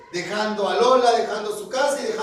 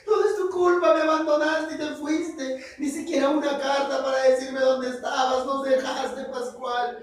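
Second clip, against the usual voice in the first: crying Lola; 5 semitones higher than usual. Acted. Lola cries and blames Pascual for leaving. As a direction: sob out the blame at him. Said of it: "Lola empezó a llorar".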